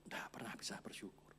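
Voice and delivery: sudden whispered line. Real, not acted. Preacher warns congregation about ingratitude in a whisper. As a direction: drop it to a whisper.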